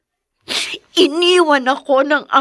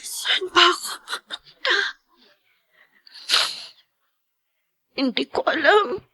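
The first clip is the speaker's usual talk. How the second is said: soft, broken weeping; lost and sobbing under her breath; crying softly; 2 semitones higher, 6 dB quieter than usual. Acted. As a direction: ask it through quiet tears. Lost daughter weeping alone.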